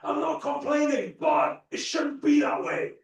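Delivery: high, slow, loud whining complainer; high slow mocking whine; whiny complainer. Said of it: "You always see people".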